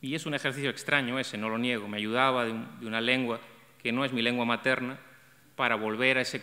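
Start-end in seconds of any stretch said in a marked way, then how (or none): none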